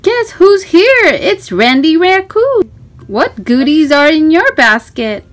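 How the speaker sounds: put-on lively storybook reading for a small child. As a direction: read it like a bedtime picture book, bright and inviting. Reading picture book to child.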